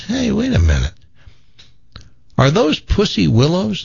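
high-pitched, struck by a discovery; city guy. Acted. City guy spotting pussy willows, curious. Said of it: "And the city guy says".

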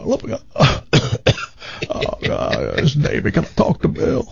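faked sick country voice on the phone; croaky Southern caller playing sick; sick Davy Lee. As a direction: prank call as a folksy country DJ. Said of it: "I could do, especially on the phone, a great Davy Lee".

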